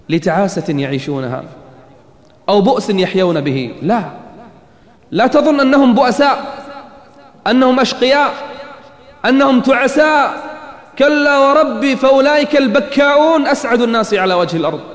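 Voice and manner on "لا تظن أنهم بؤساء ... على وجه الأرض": fervent preaching. Acted. Preaching at full force.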